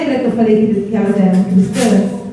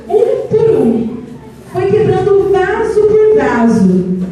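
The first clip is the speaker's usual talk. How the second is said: 1 excited emphasis; 7 semitones higher, slower than usual. A narrator's reading. Dramatic vase smashing climax.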